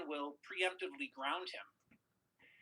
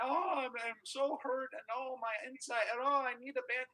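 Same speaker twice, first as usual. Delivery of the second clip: imitating crying son; 7 semitones higher than usual. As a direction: wail like a hurt little boy, hammed up. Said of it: "He's crying".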